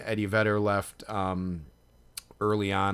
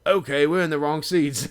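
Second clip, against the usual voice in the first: louder, higher mock Aussie realisation; Australian accent; 7 semitones higher, 9 dB louder, slower than usual. Aussie fan realises he has the wrong seats, in a loud imitated Australian accent. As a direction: imitate an Australian loudly conceding. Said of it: "do your impression of what this guy said".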